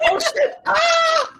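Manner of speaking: high shrieking panic; panicked scream. Acted. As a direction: scream like terrified guards. Panicking enemies voiced.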